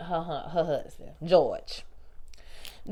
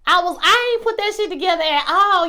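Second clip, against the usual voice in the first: excited outburst; 8 semitones higher, 5 dB louder than usual. Real, not acted. Excited rant about show twist.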